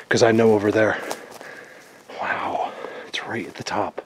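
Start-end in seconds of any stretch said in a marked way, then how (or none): 2.2-4.0 whispering. Real, not acted. Hushed discovery on the hill.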